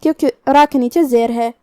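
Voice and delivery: ordinary delivery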